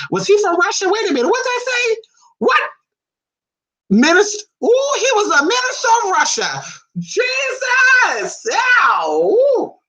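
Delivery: very high, squealing astonishment; thrilled shock. Real, not acted. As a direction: shriek with delighted disbelief. Excited discovery about a Russian minister.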